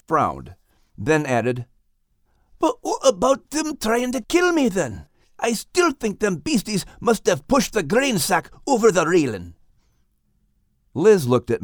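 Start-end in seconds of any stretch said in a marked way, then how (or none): 2.6-9.5 higher Scottish dog voice, pressing; Scottish dog insisting; Max the dog, Scottish lilt. Acted. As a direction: argue back in Max's brogue. Max's voice.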